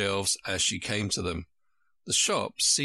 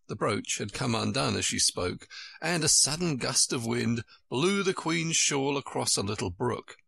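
ordinary delivery, nothing marked

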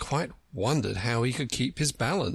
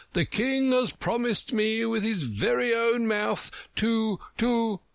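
high, pompous character voice; self-important egg leading up to a revelation; Humpty Dumpty, boastful; 12 semitones higher than usual. Acted. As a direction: boast grandly, pitched way up.

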